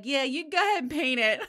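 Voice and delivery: loud, high, breaking into laughter; laughing go-ahead. Retold or imitated. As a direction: wave them on while cracking up. Laughing retelling of reply to tech.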